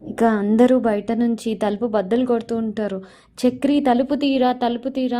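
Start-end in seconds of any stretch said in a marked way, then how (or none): 3.5-5.2 loud calls through the locked door; retold shout. Family shouting at Chakri through the door, loud. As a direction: bang and shout for him to open up. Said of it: "అని అరుస్తుంటారు".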